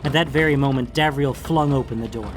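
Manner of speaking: usual speaking voice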